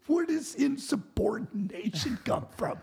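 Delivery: high-pitched retold disbelief; incredulous protest. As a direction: re-enact challenging the boss in exasperation. Retold argument with boss, incredulous.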